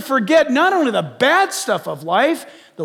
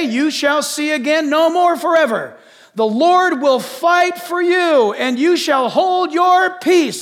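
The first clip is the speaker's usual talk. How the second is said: preaching scripture; 5 semitones higher, 3 dB louder than usual. Acted. Moses calls out to the frightened people, preaching at full force. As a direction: proclaim it like Moses rallying the people. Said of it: "and Moses said to the people".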